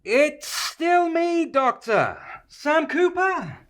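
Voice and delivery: slow, deliberate patience; slow and patient. Acted. Cooper repeating his name to the forgetful doctor, slow and patient. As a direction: spell it out slowly for a forgetful man.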